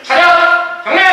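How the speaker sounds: barked, high and loud command; drill sergeant. Shown as a demonstration. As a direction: snap the salute order out hard. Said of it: "이렇게요".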